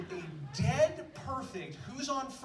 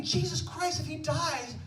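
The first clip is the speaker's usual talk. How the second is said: higher, louder, laughing panic; panicked thought; 7 semitones higher, 9 dB louder than usual. Retold or imitated. Teller holding Jim's leg, laughing panic. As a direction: blurt the worry as it grips you.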